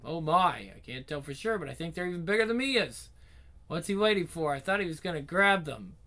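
ordinary delivery, nothing marked

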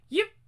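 dog yelp. Acted. Dog yelps in pain.

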